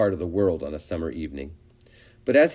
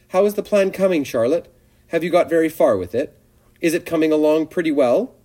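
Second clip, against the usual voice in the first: higher, louder, eager little pig; eager young pig; 8 semitones higher, 7 dB louder than usual. A narrator's reading. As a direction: pester her, trembling with hope. Wilbur pestering Charlotte.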